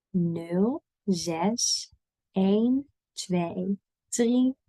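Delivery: very slow, digit by digit; spaced out with pauses for repeating; slow model reading. Shown as a demonstration. Teacher gives learners a phone number, slowly digit by digit.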